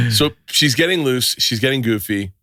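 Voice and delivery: normal talking voice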